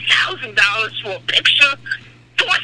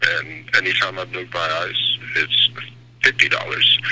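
much lower, bad rock-star voice; 4 dB quieter than usual. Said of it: "that was an awful [name] impression".

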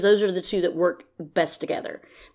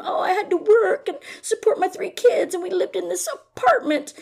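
much higher, mocking sob-story voice with a laugh; mocking Robin; 12 semitones higher than usual. Vlogger mimicking Robin's sob story for viewers, high mocking voice. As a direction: parody her pity-me tale, pitched way up. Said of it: "she comes from".

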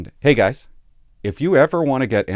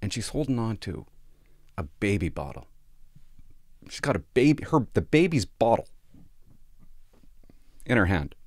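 ordinary talk

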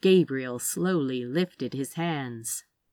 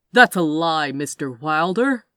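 loud, firm rebuttal; forceful denial; 8 dB louder than usual. A narrator's reading. Gabriel rejects an accusation from his captives, forceful denial. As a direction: deny it forcefully and loudly. Villain loudly denies the accusation.

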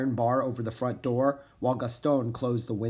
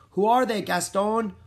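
called across the barn, high and louder; calling out; 9 semitones higher, 5 dB louder than usual. A narrator's reading. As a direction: call out to the strangers from the doorway. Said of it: "a woman called from the barn doorway".

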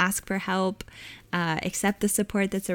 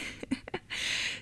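breathy laugh; 13 dB quieter than usual. Real, not acted. Laughing at own tearful quote.

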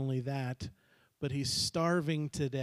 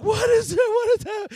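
bewildered son; 12 dB louder than usual. Acted.

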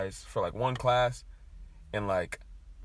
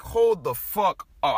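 slow, loud, higher, every word spaced out; retold anger; 6 semitones higher, 7 dB louder, much slower than usual. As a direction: stop everything, outraged. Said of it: "I got pissed".

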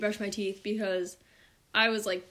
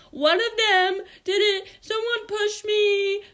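high, loud, drawn-out whine; mock-cry like a little kid tattling; wailing hurt child; 12 semitones higher, 7 dB louder, much slower than usual. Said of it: "she's fell down and she's like".

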